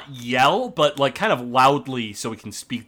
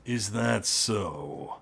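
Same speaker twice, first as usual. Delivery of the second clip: low, slow, menacing orc voice; gruff orc boss; 6 semitones lower, 9 dB quieter, much slower than usual. Acted. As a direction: growl it slowly through a door. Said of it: "the gruff voice of Lockgrim".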